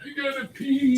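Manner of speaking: very high, playful squeak; high put-on voice. Announcing a break to the stream, playful high voice. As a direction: pitch it way up, mock-urgent.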